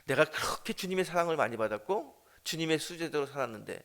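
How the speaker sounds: usual speaking voice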